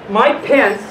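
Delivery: usual speaking voice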